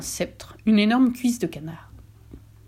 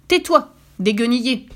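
a loud, sharp snap; curt angry retort; 4 semitones higher, 6 dB louder than usual. Acted. Wicked wife snapping back at her husband. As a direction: cut him off sharply. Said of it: "répliqua sèchement la méchante femme".

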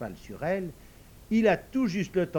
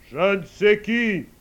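slow, much louder commanding call; majestic old man calling; 5 semitones higher, 12 dB louder, slower than usual. Acted. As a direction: summon the invisible servant like a lord. Old man calls his servant.

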